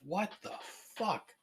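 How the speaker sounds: high, breathy near-silent disbelief; stunned hush. Real, not acted. Disbelief at the lost recording.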